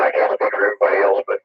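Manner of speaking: normal talking voice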